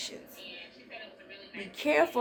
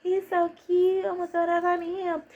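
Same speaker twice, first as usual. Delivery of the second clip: smitten woman; 8 semitones higher than usual. Retold or imitated. Pearlie fawning over the young man, high swooning coo. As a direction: coo it like she's crushing hard.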